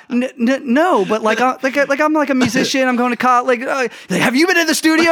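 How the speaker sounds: high, loud, stammering answer through laughter; laughing, flustered student bluffing; flustered reply, laughing. Retold or imitated. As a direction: play your younger self caught off guard. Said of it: "I was like".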